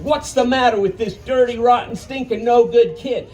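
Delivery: high, angry jabbing insults; furious scolding. Retold or imitated. Grandpa poking the boy's forehead, angry high scolding. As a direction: hammer every insult home.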